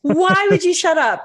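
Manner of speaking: high-pitched, incredulous teasing objection; playful protest. Real, not acted. Co-host objecting to friend offering to stop talking, playfully incredulous. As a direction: protest in mock disbelief, voice way up.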